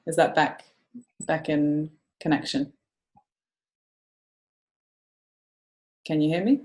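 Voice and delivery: choppy audio on a bad connection; patchy line cutting in and out; call dropping out. Real, not acted. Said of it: "it's choppy on my end".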